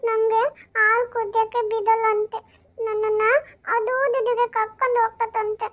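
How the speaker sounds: put-on high, tearful little girl complaining; crying toddler. Toddler crying to her aunt about the milk, high tearful voice. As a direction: sob it out like a hurt two-year-old.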